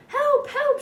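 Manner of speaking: high, loud shrieking cry for help; shrieked. A narrator's reading. Lady shrieking at a floating seaweed dog. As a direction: shriek it out in panic. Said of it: "she shrieked".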